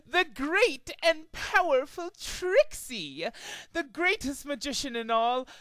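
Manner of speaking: slow, high, booming proclamation; grandiose self-introduction. Acted. Trixie introducing herself to the crowd, slow high grandiose proclamation. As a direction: announce yourself as a legend.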